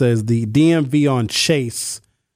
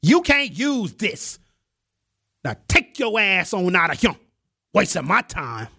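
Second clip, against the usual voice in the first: angry DMV clerk; 5 semitones higher than usual. Acted. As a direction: act out a fed-up clerk sending someone away.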